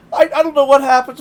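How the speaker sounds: ordinary delivery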